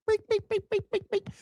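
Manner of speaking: tiny high repeated beeps; high-pitched beeping. Pretend. Describing things popping up, with high soft beeping noises. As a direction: squeak out quick little sound-effect beeps.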